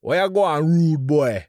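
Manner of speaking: put-on Jamaican patois lilt; Jamaican accent. Shown as a demonstration. Showing listeners what patois sounds like, Jamaican accent. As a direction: demonstrate a rough, comic Jamaican accent. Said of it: "This is the Jamaican accent".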